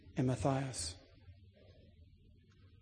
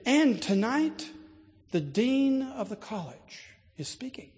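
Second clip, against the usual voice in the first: put-on higher, slow, English accent with pride; proud English steward; 8 semitones higher, 4 dB louder, slower than usual. Steward announces the dean is speaking, English accent. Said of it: "He didn't say it with my accent".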